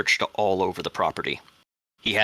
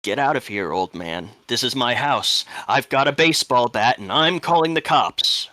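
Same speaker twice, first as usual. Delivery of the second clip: loud, higher warning yell building in force; 4 semitones higher, 7 dB louder than usual. Acted. Homeowner warning an old man off his house, yelling out. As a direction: shout a threat to drive off an intruder. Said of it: "So I yell out".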